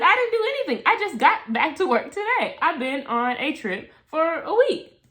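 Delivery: a shocked denial that calms as it goes; high indignant protest. Retold or imitated.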